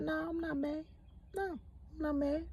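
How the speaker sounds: very soft, careful reassurance; feigned calm. Retold or imitated. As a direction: keep it hushed so he stays calm. Calming a volatile man.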